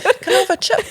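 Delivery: high, lightly voiced little kid asking; mock schoolkid. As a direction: squeak it like a little kid at lunch. Imitating kids at school lunch.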